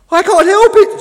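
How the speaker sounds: very high, loud panicked cry; screaming. A narrator's reading. The sinking new boat answers Tug, screaming high. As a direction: scream it helplessly. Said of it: "screamed the new boat".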